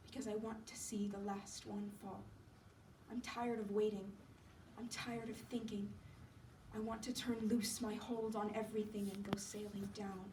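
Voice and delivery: very soft, drained invalid; weary Johnsy. Acted. Sick Johnsy longing to let go, weary and fading. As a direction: fade almost to a whisper, giving up.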